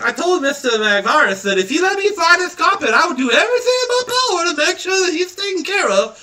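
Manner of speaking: put-on high, breathless eager boy; eager kid voice. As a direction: gush like a starstruck little kid.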